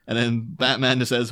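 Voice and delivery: normal talking voice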